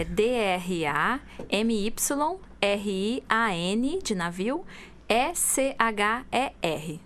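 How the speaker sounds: letters spelled out slowly one by one; spelling it out. Shown as a demonstration. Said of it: "Aí eu vou soletrar". (soletrando devagar, letra por letra)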